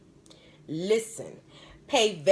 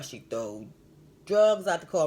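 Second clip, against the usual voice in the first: ordinary talk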